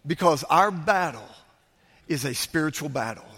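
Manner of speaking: low, measured sermon close with weighty pauses; subdued, deliberate pulpit delivery; solemn preaching. Real, not acted.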